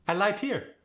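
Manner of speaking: high-pitched Khmer accent, R softened to L; Khmer-accented "light" for "right". Shown as a demonstration. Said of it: "The R was softened into an L".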